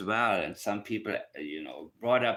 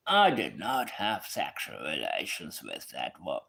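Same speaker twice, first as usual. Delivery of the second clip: lower, put-on Clinton voice; a rough try at Bill Clinton's voice; half-hearted Clinton impression, low; 5 semitones lower than usual. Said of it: "I cannot do Bill Clinton".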